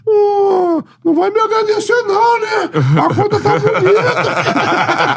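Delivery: high-pitched teasing coach impression; Jair's voice. Jair voiced in retold call.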